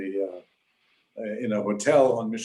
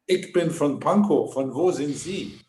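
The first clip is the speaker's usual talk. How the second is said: higher-pitched, punchy German-speaking cabbie; imitated taxi driver; 4 semitones higher, 3 dB louder than usual. Taxi driver turning to his German passenger, raised lively voice. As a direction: play the cabbie calling back in German. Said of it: "taxi driver turns around and says".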